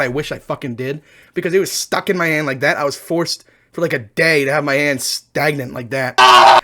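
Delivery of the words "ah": loud sudden ah; short theatrical scream; mock pain yell. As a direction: cry out at the remembered sting. Cries out at remembered splinter.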